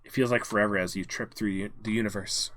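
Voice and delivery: usual speaking voice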